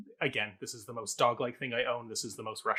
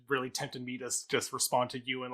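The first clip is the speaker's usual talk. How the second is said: usual speaking voice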